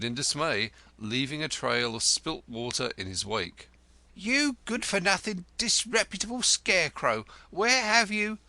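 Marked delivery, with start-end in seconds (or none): 4.1-8.4 high, shrill-edged scolding; furious landlady scolding the lodger; 1 scolding wife. A narrator's reading. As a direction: tear into him, higher and higher. Wife berates returning lodger.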